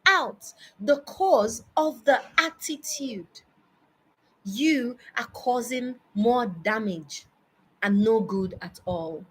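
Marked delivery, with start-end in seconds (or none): none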